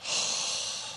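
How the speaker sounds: a long, soft unvoiced sigh out; imitating a distraught man's deep sigh; retold heavy sigh. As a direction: let out a weary breath, barely voiced. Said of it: "And he just went".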